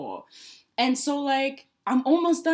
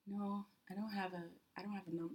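very soft, low mumbled excuse; awkward refusal; 3 semitones lower, 19 dB quieter than usual. Retold or imitated. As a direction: turn them down almost inaudibly. Retelling an awkward refusal of number.

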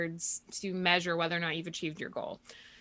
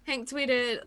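usual speaking voice

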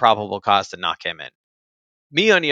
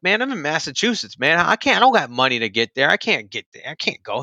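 higher-pitched, pleading objection; retold exasperated protest; 5 semitones higher than usual. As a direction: protest helplessly, as retold to a friend. Retold protest at summons to California.

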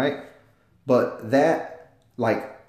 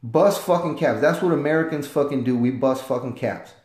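normal talking voice

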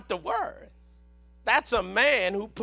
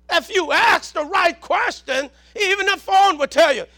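raised-pitch sermon delivery; emphatic preaching; 6 semitones higher, 4 dB louder than usual. Real, not acted. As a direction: preach it up high in punchy phrases.

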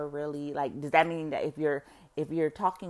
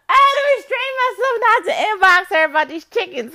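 much louder and higher, fast and worked up; excited rant; 12 semitones higher, 12 dB louder, faster than usual. Real, not acted. Telling viewers about the neighbour's chickens, loud excited gushing. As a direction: gush about the chickens you can't stop thinking of.

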